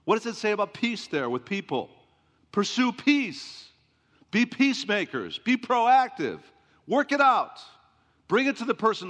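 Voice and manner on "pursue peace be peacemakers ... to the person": louder, higher preaching push; preacher building to a loud call; rising exhortation. Acted. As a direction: drive each command home harder.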